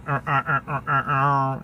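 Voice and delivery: string of short, repeated robot syllables; robotic voice noises. Shown as a demonstration. Describing his inner voice to viewers, robotic imitation. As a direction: imitate a robot in staccato "uh" sounds. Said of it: "it's like a robotic voice".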